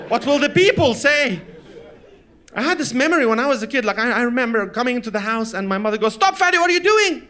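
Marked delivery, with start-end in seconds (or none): 0.1-1.4 louder, higher, drawn-out mother's wail; slow, loud mock despair; mock outcry. Mother fretting, escalated repeat. 6.1-7.3 high, loud panicked scolding; imitated frantic mother shout; alarmed mother's yell. Mother yells at child to stop.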